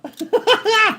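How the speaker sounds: high-pitched burst of laughter. Real, not acted. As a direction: burst out laughing at your own bit. Laughs at own mock accusation.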